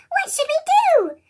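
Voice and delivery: put-on children crying out high in alarm; worried cry. A narrator's reading. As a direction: call out in a high, anxious child voice. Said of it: "The children cried out".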